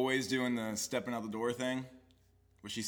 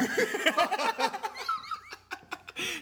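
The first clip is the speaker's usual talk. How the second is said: big high-pitched laugh. Real, not acted. Laughing at own story.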